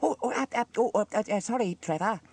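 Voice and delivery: high, flustered stammer. A narrator's reading. Elvis apologises to Trevor, stammering. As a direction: stutter helplessly into an apology. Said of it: "stammered Elvis".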